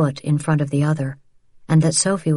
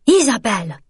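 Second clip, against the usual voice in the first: sharp, raised voice on the name; harsh rebuke; 6 semitones higher, 3 dB louder than usual. A narrator's reading. Mother scolding her sister in the street, mildly harsh. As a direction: snap her name to stop her, a touch harsh. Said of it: "Vianne said harshly".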